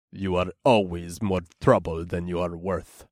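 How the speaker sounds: gruff, disapproving orc. A narrator's reading. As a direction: grumble disapprovingly. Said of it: "said disapprovingly".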